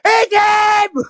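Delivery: high yelled egging-on; mock shout urging him to hit; hammed-up high yell from a car; imitating Buggy yelling. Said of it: "and going".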